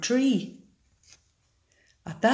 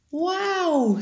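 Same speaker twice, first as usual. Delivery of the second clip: high, amazed owl voice; a little owl gasping in wonder; wise hooting owl; 8 semitones higher than usual. A narrator's reading. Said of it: "said the owl".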